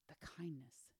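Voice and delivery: soft hushed whisper; the kindness, whispered. Real, not acted. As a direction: let it fall to a whisper. Preacher drops to a hush.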